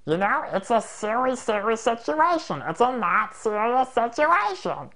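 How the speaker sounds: high silly put-on voice; mock-serious high comic voice; funny voice. Shown as a demonstration. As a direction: say it in a goofy high voice. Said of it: "use a funny voice".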